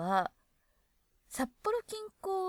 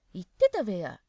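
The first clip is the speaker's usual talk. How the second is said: 言ってたべや pushed louder, pressing the point; insistent beya; 6 dB louder than usual. Shown as a demonstration. Explaining nuance of beya, insistent reminding tone. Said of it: "っていう感じ".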